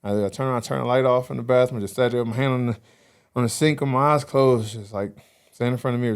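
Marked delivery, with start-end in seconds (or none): none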